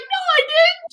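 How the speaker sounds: very high acted denial; high-pitched retold bickering; mock protest. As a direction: imitate a squabbler protesting innocence. Acted argument over the crying.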